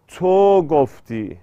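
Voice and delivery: تو held long in تو گفتی; تو گفتی slowly, with a drawn-out to; lengthened vowel. Shown as a demonstration. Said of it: "این تو رو کشیده".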